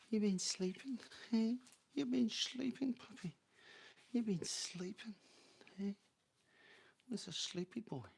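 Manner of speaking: high cooing voice for a pet, soft and sing-song. Real, not acted. Owner cooing high to her sleepy dog, pet baby talk.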